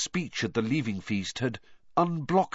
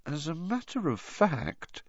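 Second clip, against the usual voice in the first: high, softer voice with a slight tremble; a touch of quaver, pitched up and quiet; slightly trembling; 8 semitones higher, 6 dB quieter than usual. A narrator's reading. Hermione adding a fact about Rita, slightly trembling. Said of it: "her voice now trembling slightly".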